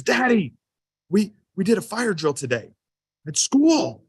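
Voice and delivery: put-on high, excited little boy voice. Dad imitating son reporting the fire drill, high and excited. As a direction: announce it like an eager five-year-old.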